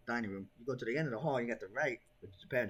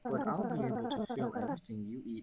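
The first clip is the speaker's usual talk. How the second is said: creepy doll voice; 10 semitones higher, 4 dB louder, slower than usual. Acted. Doll character speaks.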